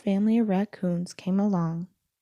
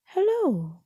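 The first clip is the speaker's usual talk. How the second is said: calling out hello; 8 semitones higher than usual. A narrator's reading. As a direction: pop out of the dark with a cheery hello. Voice calls from the dark.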